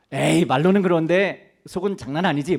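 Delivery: sceptical teasing. Retold or imitated. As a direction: needle him like you don't buy it.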